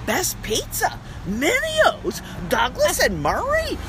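thrilled at spotting her own street in the magazine; excited discovery. Retold or imitated. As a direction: read it out like you can't believe your luck. Reading a headline with excitement.

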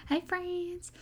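high, drawn-out mock country twang; teasing high-pitched country greeting; country accent. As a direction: mimic his exaggerated country accent. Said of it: "in his countryest accent".

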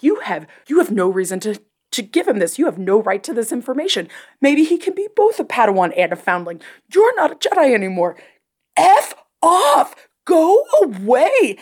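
firm, then rising to a high, loud crying yell; building rant. Retold or imitated. Host yelling at Ahsoka on screen, building to a cry. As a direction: start steady, climb into a tearful shout. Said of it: "I'm like".

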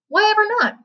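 sudden high disbelieving question; surprised. A narrator's reading. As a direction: pitch leaps up in surprise. Wonka surprised, high exclaim.

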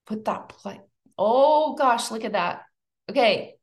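ordinary talk, nothing marked

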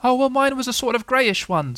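high small-creature voice; timid Piglet. Acted. Piglet's put-on voice.

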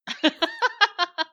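high, loud laugh bursting out; loud burst of laughter. Real, not acted. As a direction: crack up loudly. Laughing at redneck joke.

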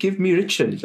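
normal talking voice

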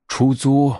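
mildly startled grandpa bear; taken aback. A narrator's reading. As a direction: echo it as a surprised question. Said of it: "熊爷爷吃了一惊".

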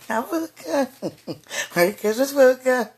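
bright, high, loud and playful; playful excited. Real, not acted. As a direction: sing out a giddy greeting. Playfully calling God boo.

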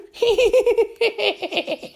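very high, squeaky gloating cackle; gleeful imp cackle. Acted. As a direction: cackle like a greedy little imp. Glomulus cackles after victory.

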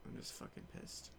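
whispered under breath; whispered pissed. Real, not acted. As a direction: hiss it out softly. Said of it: "I don't know".

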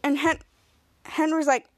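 usual speaking voice

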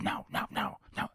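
yelling imitated at held-back volume; mock aggressive yell. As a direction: bark it out without real loudness. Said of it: "like, so loud and so".

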